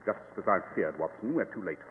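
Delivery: a low, somber murmur; grave and hushed. Acted. Finding the body.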